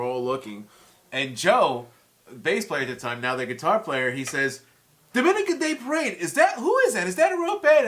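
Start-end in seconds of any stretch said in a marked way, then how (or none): 5.1-7.9 mock puzzled question. Joe asks about fake band.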